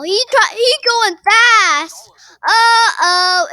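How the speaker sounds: loud, slowed panicked yell; yelling as he speeds up; excited shout. Real, not acted. Being chased by fast Sonic.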